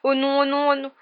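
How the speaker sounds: high, loud frightened wail; panicked moaning. A narrator's reading. Ron as the creature nears, moaning in panic. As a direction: moan it over and over in rising dread. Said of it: "gémit Ron".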